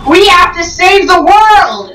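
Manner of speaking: high, slow, hammed-up hero call; rally everyone like a cartoon hero; mock heroic cry. Star-Lord rallying the team to save the world, high and slow. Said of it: "he's like".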